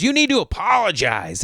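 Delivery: high-pitched mock scolding; imitated yelling demand. Woman ordering the golfer to apologise, mock yell. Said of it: "She's yelling at me".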